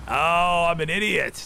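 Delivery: high, loud exclamation at yourself; loud self-reproach. Real, not acted. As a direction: cry out at your own silly mistake. Sudden realisation of own mistake.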